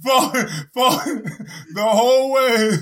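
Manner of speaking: hammed-up dying farewell, high and crying; wounded soldier gasping. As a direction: wail your last words while falling. Mock fall off cliff, last words.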